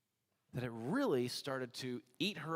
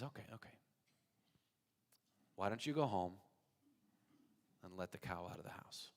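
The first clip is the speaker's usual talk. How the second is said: much softer, patient reply; calm rabbi; 6 semitones lower, 9 dB quieter than usual. Acted. Rabbi calmly answers again.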